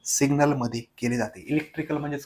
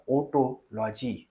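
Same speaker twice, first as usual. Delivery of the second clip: Otology drawn out very slowly, syllable by syllable; slowly enunciated for the class; slow, spelled-out term; 3 semitones lower, 11 dB quieter, much slower than usual. Shown as a demonstration. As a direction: stretch otology out so students catch it. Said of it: "काय म्हणतात?".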